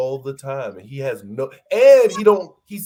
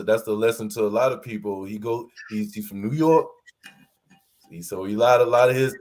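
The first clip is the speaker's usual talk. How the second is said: ordinary delivery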